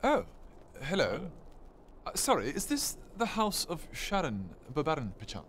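put-on halting, over-polite posh accent; nervous posh. Son asks stranger about his parents' house, awkwardly posh. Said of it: "posh when he's nervous".

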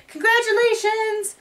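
very high, sing-out congratulations; high-pitched celebratory call; celebratory cheer. Pretend. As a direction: cheer them on in a high put-on voice. Cheering a new citizenship.

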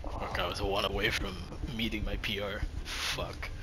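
soft, low muttered curse; quiet disappointed muttering. Real, not acted. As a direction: mutter it under breath.

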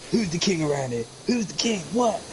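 imitated bully's taunting demand; mock-domineering, pitch leaping up; bullying movie thug. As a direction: play the film bully lording it over his victim. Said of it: "He's always bullying him".

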